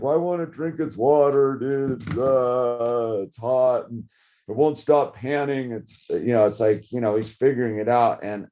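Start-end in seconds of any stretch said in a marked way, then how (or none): 0.0-5.7 mock frustrated whine, slightly raised; frustrated kid. Son fretting that the dog won't drink, mildly frustrated. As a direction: imitate a child's mild exasperation. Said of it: "he's all frustrated".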